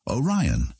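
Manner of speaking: ordinary delivery, nothing marked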